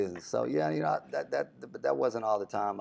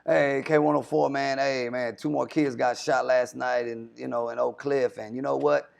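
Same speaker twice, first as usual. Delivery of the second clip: loud, high, careless shock-jock hype; hyped radio DJ; 3 semitones higher, 5 dB louder than usual. Retold or imitated. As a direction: pump it up like a party DJ ignoring bad news. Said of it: "But I'm not just gonna be like".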